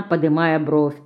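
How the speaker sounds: ordinary delivery, nothing marked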